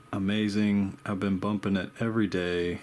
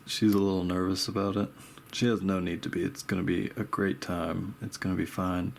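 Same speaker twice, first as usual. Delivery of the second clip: ordinary delivery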